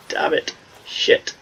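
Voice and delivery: slow, breathless whispered swearing; whispered curse. Retold or imitated. Son swears under his breath as mum approaches, whispered. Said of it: "I'm like".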